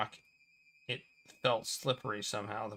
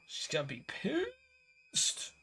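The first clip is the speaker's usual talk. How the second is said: hammed-up wail, much higher and slower; a fake sob pitched way up; mock wail; 3 dB quieter, slower than usual. Mock wailing.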